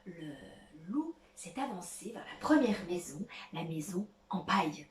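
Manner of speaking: hushed, low storytelling whisper. A narrator's reading. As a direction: whisper it to build suspense.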